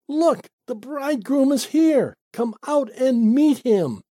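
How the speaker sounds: high, excited calling out; ringing read-aloud shout; announcing shout. Acted. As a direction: cry the news out, pitched well up. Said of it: "at midnight there was a shout".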